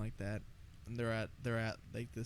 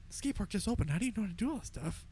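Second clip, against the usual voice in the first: amazed; 8 semitones higher, faster than usual. Retold or imitated. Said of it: "I'm like".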